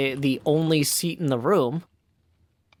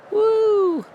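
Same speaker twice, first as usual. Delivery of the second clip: excited woo; 5 dB louder than usual. Real, not acted. Excited cheer flying by trident.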